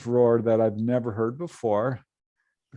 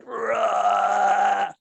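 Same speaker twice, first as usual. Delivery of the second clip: mock roar; 8 semitones higher, 3 dB louder than usual. Imitating the creature's roar.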